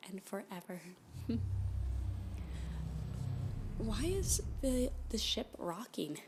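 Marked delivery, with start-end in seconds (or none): 0.9-3.7 giggle. Acted. Ship starts rocking.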